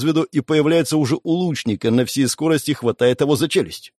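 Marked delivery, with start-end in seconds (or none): none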